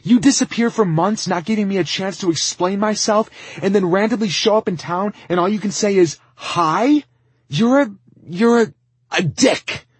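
high-pitched, very loud tirade; furious shouting. Acted. Lizzie rails at Tristan for disappearing, furious shouting. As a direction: rage at full volume, stumbling into the insult. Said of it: "she shouted".

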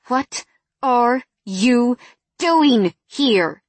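slow, drawn-out, each word weighted; slow cold anger. A narrator's reading. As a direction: spell it out slowly with menace. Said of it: "I narrowed my eyes at him".

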